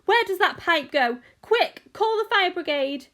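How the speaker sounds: high, loud urgent demands; frantic yelling. A narrator's reading. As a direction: shout for emergency help in a panic. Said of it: "yelled Missus Gloop".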